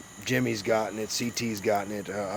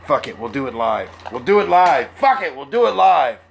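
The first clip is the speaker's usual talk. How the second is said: mock angry outburst; 3 semitones higher, 10 dB louder than usual. Man losing patience before going live, shouting in mock fury. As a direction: build from firm to full shouting.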